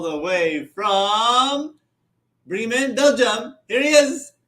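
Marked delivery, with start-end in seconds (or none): none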